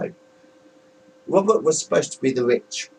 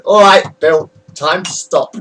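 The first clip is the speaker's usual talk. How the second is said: mock brother's order; 5 semitones higher, 10 dB louder than usual. Brother voiced in retold story.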